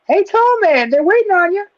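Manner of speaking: high mock call to the host; imitating her high voice. Host imitating the producer calling him back from commercial, high. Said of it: "She's like".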